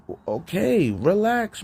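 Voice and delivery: ordinary talk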